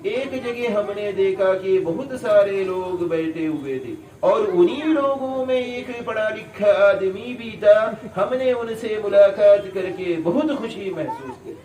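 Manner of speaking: Urdu in a lightly imitated Malayali accent; Kerala Urdu accent. Teacher imitates Kerala people speaking Urdu, in a light Malayali accent. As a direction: mimic a Kerala learner's Urdu, half-amused. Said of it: "بولتے ہیں آ کر".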